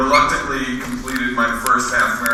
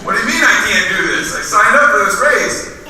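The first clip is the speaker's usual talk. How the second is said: indignant protest; 7 semitones higher, 4 dB louder than usual. Retold or imitated. Brad protests the coach's verdict.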